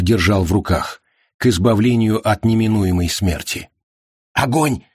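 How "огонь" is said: shouted command. Acted. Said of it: "крикнул Арчер".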